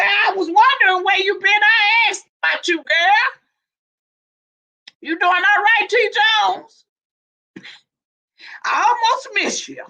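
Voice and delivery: thrilled high-pitched hello to a friend; excited greeting. Real, not acted.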